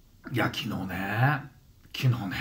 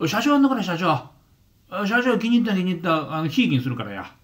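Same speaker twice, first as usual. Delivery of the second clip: fawning Osaka guy; 6 semitones higher than usual. Retold or imitated. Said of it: "みたいな".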